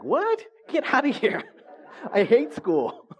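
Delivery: high-pitched, loud mock outrage, laughing; indignant brother. Brother refuses to play school with sibling, indignant. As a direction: protest like an appalled kid brother, voice shooting up.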